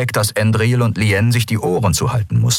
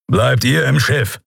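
Kero's stern order; 6 semitones higher, slower than usual. Acted. Kero orders the others to stay.

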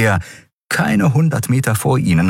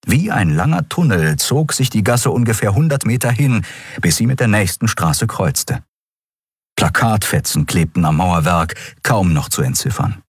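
usual speaking voice

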